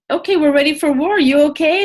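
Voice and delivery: brisk, pepped-up imitation of a driver checking in; acting out a ready-to-go check-in; upbeat readiness check. Voices Krishna checking on Arjun.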